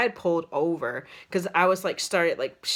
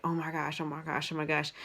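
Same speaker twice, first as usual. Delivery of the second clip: hushed frightened gasp; 2 semitones lower, 9 dB quieter, faster than usual. Retold or imitated. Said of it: "and I was like".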